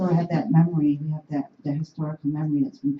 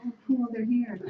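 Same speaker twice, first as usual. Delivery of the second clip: higher, delighted mimic; excited women; 7 semitones higher, 3 dB quieter than usual. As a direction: imitate the thrilled women, lightly. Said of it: "they're like".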